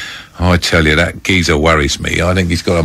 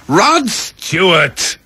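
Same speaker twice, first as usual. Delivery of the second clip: retold scream; 11 semitones higher, much slower than usual. Host retells a tipsy guest screaming the answer, stretched mock yell. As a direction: drag the name out like a drunk party shout. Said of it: "I screamed".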